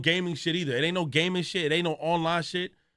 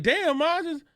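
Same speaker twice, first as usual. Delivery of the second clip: high, whining excuse to mom; whiny pleading; 11 semitones higher than usual. Retold or imitated. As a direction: plead your case in a high whine. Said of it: "I'm like".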